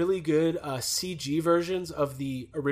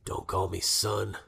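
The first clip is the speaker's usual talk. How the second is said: low, slow tough-guy voice; Logan; 9 semitones lower, 7 dB quieter, slower than usual. Retold or imitated. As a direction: drop low and drag it out, brooding. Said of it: "who sits down at the bar? Logan".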